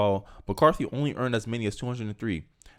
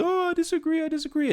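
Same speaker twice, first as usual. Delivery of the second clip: mocking. Mocking the squad's public protest.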